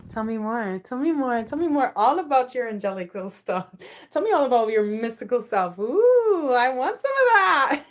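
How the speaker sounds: building to high, loud excitement; mock eager fascination. Captivated person begging to hear about angelic stuff, hammed-up excitement.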